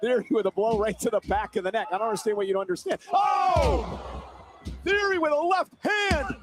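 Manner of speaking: excited live play-by-play; wrestling commentator. Acted. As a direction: call the match as it happens.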